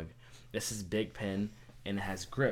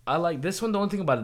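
excited; 9 semitones higher, 10 dB louder, much faster than usual. Real, not acted. Excited about the pen.

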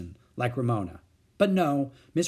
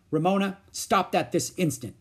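higher, louder stern order; 6 semitones higher, 4 dB louder than usual. A narrator's reading. Beezus ordering her sister to stop. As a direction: lay down the law. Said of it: "Beezus ordered".

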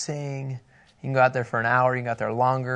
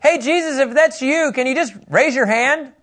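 much higher, jokey casual ask; mocking Peter; 11 semitones higher, 4 dB louder than usual. Preacher mocks Peter asking Jesus for a sign, high and jokey. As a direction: play Peter as a goofy, casual asker. Mock version of Peter's test.

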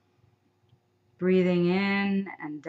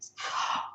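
breathy sigh; 6 dB quieter than usual. Shown as a demonstration. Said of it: "we'll add the sound of".

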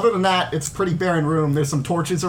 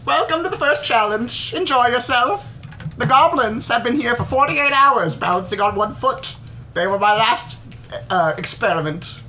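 high, sing-song game host voice; squeaky showman welcoming the players; bright squeaky sing-song welcome; 6 semitones higher than usual. Acted.

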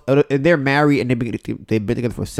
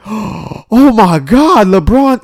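much higher, louder, very slow gasping amazement; overacted shock; 11 semitones higher, 10 dB louder, much slower than usual. Shown as a demonstration. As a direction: ham up the shock for the camera, drawing out LeBron. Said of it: "that's not how people do that".

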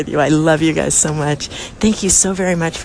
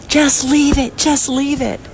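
disgusted; 7 semitones higher than usual. Retold or imitated. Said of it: "is disgusted".

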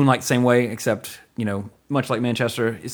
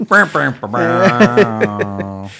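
mock failure buzz; 2 semitones higher, 6 dB louder, much slower than usual. Mocking a failure sound.

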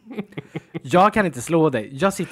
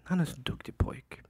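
tender whisper to dog; 9 dB quieter than usual. Real, not acted. Praising his dreaming dog.

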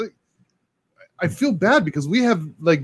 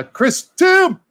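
slow, drawn-out hype call, a bit higher; announcer call; 13 semitones higher, 5 dB louder than usual. Acted. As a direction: call the name out like a ring announcer. Announcing a guest's arrival.

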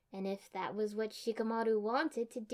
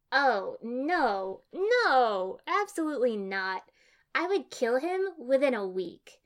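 loud, outraged protest; emphatic refusal; 4 semitones higher, 8 dB louder, slower than usual. A narrator's reading. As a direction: reject it loudly and forcefully. Ino rejects the idea outright.

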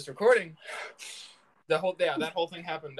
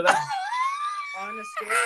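high laughing yelp mid-sentence; laughing shriek; 3 dB louder, much faster than usual. Real, not acted. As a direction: squeal through a laugh. Being assaulted while talking.

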